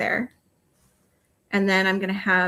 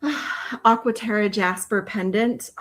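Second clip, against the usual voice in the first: ordinary talk, nothing marked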